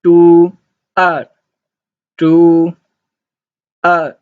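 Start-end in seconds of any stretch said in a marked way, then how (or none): 1.0-1.3 R starting high and falling sharply; short falling Mandarin tone on R; fourth tone. Shown as a demonstration. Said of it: "fourth tone". 3.8-4.2 R starting high and falling sharply; short falling Mandarin tone on R; fourth tone. Shown as a demonstration. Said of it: "fourth tone".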